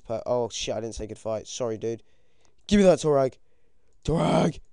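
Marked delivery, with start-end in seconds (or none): 2.6-4.6 loud, high, greedy shout; excited demand. Real, not acted. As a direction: call out eagerly for the loot. Gloating as he takes loot.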